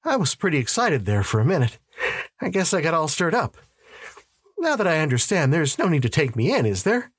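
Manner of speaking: high, hurried self-excusing; uneasy reassurance to the police; anxious backpedalling. Acted. Relieved suspect talks his way out.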